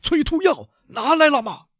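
very high urgent shout. A narrator's reading. Villefort running out, shouting for the emetic. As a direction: yell down the stairs, pitch soaring. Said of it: "一面叫道".

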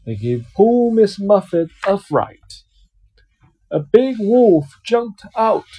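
lively picture-book reading voice; reading to a child. Acted. Dad reading a picture book to his child, storytelling voice. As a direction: read it out like a bedtime story for a little one.

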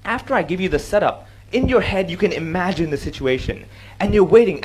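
ordinary talk

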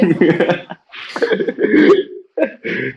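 loud low laugh; hearty laugh. Real, not acted. Laughing at the hen comparison.

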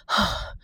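very soft, breath-only gasp; whispered "ah" of relief after fright. A narrator's reading. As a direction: let out a quiet voiceless sigh of shock. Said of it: "孙娜突然说".

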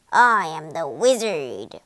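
low, heavy monster voice; roaring beast. A narrator's reading. Terrible beast announcing itself to the Tin Man, low roar. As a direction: growl it out low, like a great beast. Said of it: "roared the beast".

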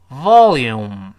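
"volume" loud, stressed first syllable, lips closed on m; slow teaching pronunciation. Shown as a demonstration. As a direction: drill "volume" with a clear V and forward-tongue L. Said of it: "再来一遍". (慢而清楚地领读“volume”)

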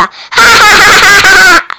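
shouted ha-ha-ha, high and loud; loud mocking laugh. Shark jeering loudly at frog after the quiz. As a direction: laugh right in his face.

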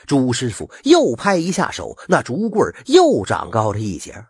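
ordinary delivery, nothing marked

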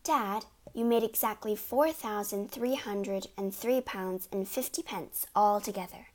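usual speaking voice

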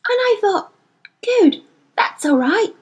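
put-on high, slowed girl voice; Lyra, relieved. A narrator's reading. Girl confessing her trust to the boy, gently. Lyra's voice.

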